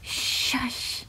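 soft whispered shushing; whispered hush. A narrator's reading. Girl hushing her dog so Mom won't hear, whispered. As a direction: hush him under your breath. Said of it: "Shannon whispered to him".

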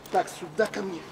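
normal talking voice

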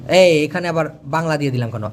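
normal talking voice

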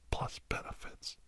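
normal talking voice

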